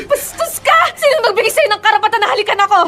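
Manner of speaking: outraged. Acted. Slapping back at a stolen kiss.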